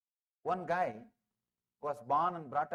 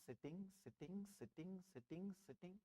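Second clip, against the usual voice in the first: fading repetition; 18 dB quieter than usual. A narrator's reading. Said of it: "repeated sitting, fading".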